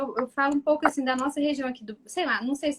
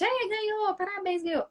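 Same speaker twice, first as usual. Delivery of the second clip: cheering parent; 7 semitones higher than usual. Retold or imitated. Parents cheering son's game win.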